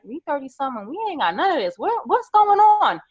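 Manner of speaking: mock bewilderment, rising to a high cry; exasperated quote. Friends in their thirties asking what's going on, climbing high. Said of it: "we're like".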